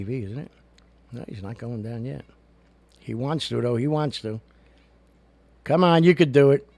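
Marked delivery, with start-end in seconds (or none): 5.6-6.7 higher, louder encouragement; cheering the pet to go down. Real, not acted. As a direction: urge the pet on.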